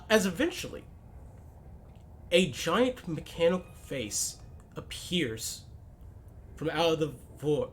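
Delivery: ordinary talk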